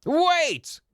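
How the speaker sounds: high, loud cry to stop the verdict; urgent shout. Acted. Lawyer bursting in to stop the verdict, shouting. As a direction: call out to halt the trial. Phoenix bursts into court objecting.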